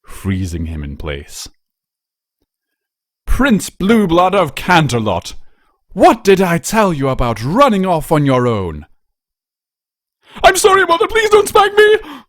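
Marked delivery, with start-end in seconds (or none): 3.2-8.9 much higher and louder, a stern noble mother scolding; booming commanding matron. A narrator's reading. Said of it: "the commanding voice of a distinguished matron boomed". 10.4-12.2 put-on very high, loud, terrified child pleading; panicked shout. A narrator's reading. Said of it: "shot awake in a panic, shouting almost incoherently".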